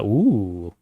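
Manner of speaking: ordinary talk, nothing marked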